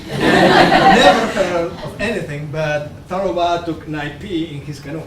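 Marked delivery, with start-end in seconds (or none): 0.1-3.6 laughing. Real, not acted. Laughing god claims the legend.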